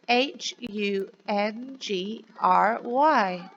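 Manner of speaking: put-on slow, clear letter-by-letter spelling for children. Shown as a demonstration. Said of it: "can you say it with me".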